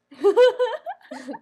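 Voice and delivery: loud, high burst of laughter. Real, not acted. Laughing at own jab at Cañitas readers, loud laugh.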